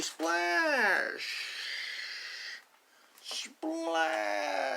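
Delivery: high, loud, drawn-out SPLASH; Splash! cried out high and long. Shown as a demonstration. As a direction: shout Splash! like a big jump. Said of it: "that's how we say splash".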